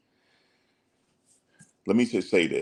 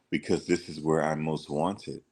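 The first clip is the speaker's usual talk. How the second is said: softer, hushed and self-assured, like a false messiah; a lightly voiced calm cult leader; imitating his soft talk; 6 dB quieter than usual. A scammer answers his follower, soft and serene. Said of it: "That's how he talked".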